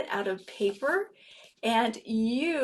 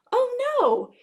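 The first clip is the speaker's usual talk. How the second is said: much higher, playful oh no; mock surprise; 13 semitones higher, 5 dB louder than usual. Correcting the guess to children, mock surprised. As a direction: gasp it up high in fun.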